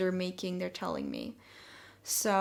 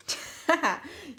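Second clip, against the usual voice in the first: giggle; 8 semitones higher than usual. Real, not acted. Reacts to the change card.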